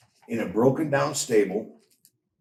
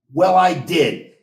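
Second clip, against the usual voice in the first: louder, slow, curt and cross; 5 semitones higher, 9 dB louder, slower than usual. A narrator's reading. Benjamin answers his brother crossly. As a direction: snap back in sulky annoyance. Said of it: "Benjamin said crossly".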